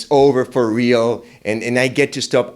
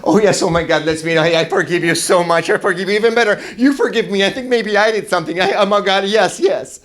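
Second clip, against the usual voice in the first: high, loud, gushing eager reply; overjoyed babbling forgiveness, way up high; mock calm, really giddy and high; ironically "calm" gushing; 9 semitones higher, 4 dB louder than usual. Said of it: "To which I very calmly answer".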